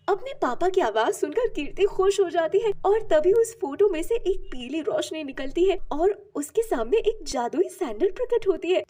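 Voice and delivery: usual speaking voice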